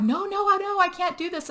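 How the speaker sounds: very high mock pleading; pleading panic. Luke retold refusing another hit, pleading high. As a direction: beg off in a strained, high acted voice.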